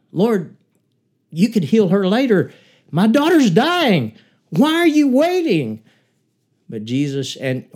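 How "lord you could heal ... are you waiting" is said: loud, much higher, rising frantic cry; desperate plea. Retold or imitated. Jairus urging Jesus to hurry to his dying daughter, loud desperate cry. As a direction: cry out like a father whose child is dying. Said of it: "Jairus must have thought".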